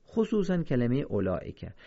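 usual speaking voice

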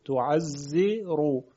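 «تعزرو» slowed right down to show the "ze" before ر; very slow teacher's model of «تعزرو»; drawn-out demonstration. Shown as a demonstration. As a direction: stretch each syllable of «تعزرو». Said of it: "ما قبلش ز".

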